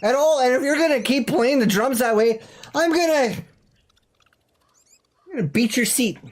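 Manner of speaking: mock angry scolding. Host scolding Marcus over the drums, mock angry and high. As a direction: scold him like a furious boss, half in jest.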